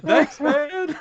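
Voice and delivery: high, laughing mock reply; imitated bemused thanks. Streamers thanking the stranger who crashed their video, high laughing imitation.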